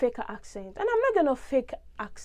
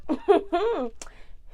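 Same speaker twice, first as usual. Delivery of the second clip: short higher-pitched giggle; amused chuckle; 6 semitones higher than usual. Real, not acted. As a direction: chuckle through closed lips. Amused after saying jackpot.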